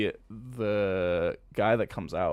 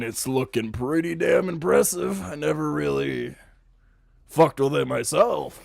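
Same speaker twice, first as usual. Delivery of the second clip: raised, brash swaggering NPC voice; cocky higher voice; 5 semitones higher, 6 dB louder than usual. Acted. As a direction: play him loud and pleased with himself. GM voices flirty Hebrus-like figure.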